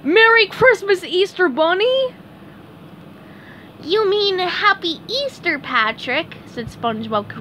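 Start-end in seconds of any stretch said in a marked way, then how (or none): 0.0-2.1 high-pitched cheerful cartoon greeting; excited Patrick. Patrick greets the Easter Bunny, excited. 3.8-6.4 high cartoon voice, correcting a friend; SpongeBob correcting. A narrator's reading. SpongeBob corrects his friend Patrick's greeting, correcting. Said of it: "correcting him".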